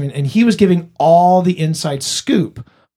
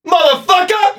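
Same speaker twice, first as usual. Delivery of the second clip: Samuel Jackson shout; 13 semitones higher than usual. Acted. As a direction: bellow the curse like an action star. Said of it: "the Samuel Jackson Stout".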